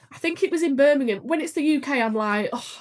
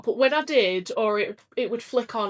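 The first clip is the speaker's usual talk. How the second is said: ordinary talk, nothing marked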